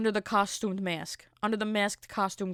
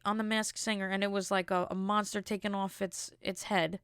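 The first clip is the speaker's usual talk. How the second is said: ordinary talk, nothing marked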